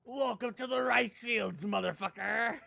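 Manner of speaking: high, loud put-on catchphrase voice; Filthy Frank impression. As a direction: belt the catchphrase like the character. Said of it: "Filthy Frank".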